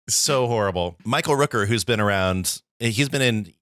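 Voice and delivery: normal talking voice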